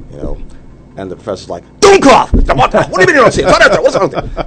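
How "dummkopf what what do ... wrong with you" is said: furious shout, much higher and louder; berating yell. Retold or imitated. Professor berates a dim student, furious high yell. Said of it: "just berates the student mercilessly".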